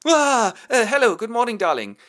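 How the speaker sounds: high, loud flustered stammer; nervous bride greeting her fiancé; flustered girl. Acted. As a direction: caught out, overly bright.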